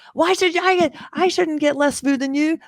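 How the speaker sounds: high-pitched mock indignant protest; angry Sanji. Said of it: "I love how angry Sanji is".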